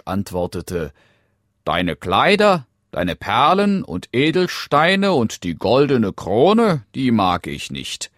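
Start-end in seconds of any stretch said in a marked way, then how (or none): 1.6-8.1 loud, high frog reeling off a list; dismissive frog. Acted. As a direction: reject each treasure emphatically as the frog. Frog rejects the listed treasures. (abwehrender Frosch, laut und betont)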